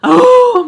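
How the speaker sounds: loud, high startled "oh"; sudden yelp of alarm, acted out; high whoop of retold fright; startled cry. Startled by noise at door.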